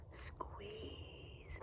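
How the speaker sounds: ordinary delivery, nothing marked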